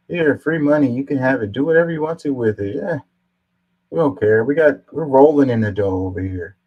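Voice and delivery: exaggerated carefree giveaway voice; mock generosity. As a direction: hand out cash with breezy sarcasm. Mocking careless givers of free money.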